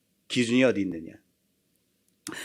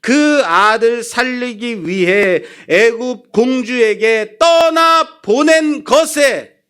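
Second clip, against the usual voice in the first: high, slow, forceful preaching; impassioned preaching; 7 semitones higher, 5 dB louder, slower than usual. Real, not acted. Preacher on a mother letting go of her son, impassioned proclamation. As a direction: proclaim each phrase, drawn out and rising in force.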